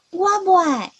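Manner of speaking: high, falling cry of refusal; loud reply. A narrator's reading. Leopard cat refuses the people's protection, loud reply. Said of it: "阿石伊大声应讲".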